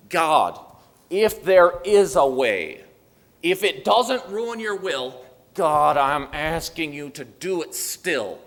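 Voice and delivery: loud, drawn-out plea to God; earnest pleading prayer. Acted. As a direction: cry out to God slowly, loud and insistent.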